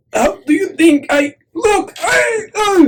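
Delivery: very high puppet voice; high-pitched, self-admiring puppet; showing-off puppet. Acted. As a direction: pipe up high, asking how you look. Puppet shows off, trying item on.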